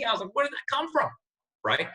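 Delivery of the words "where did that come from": much higher, amazed at himself; astonished at his own comeback; shocked. Retold or imitated. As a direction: gasp it out as if stunned by your own words. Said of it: "No one was more shocked than me".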